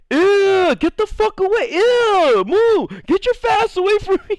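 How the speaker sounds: very high, grossed-out shrieks; disgusted yelling. Retold or imitated. Classmates recoiling from the boy, disgusted shrieks.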